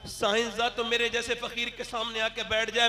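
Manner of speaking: normal talking voice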